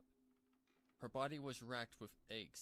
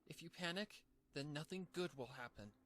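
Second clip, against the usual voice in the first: high pony voice, shakily reassuring; high cartoon pony, nervous self-talk; Pinkie steadying herself; 5 semitones higher than usual. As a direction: coax yourself to stay calm.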